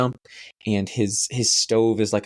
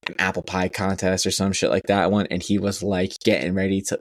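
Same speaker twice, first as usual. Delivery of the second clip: usual speaking voice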